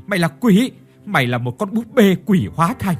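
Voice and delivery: terrified shriek. Acted. Terrified old woman accusing maid.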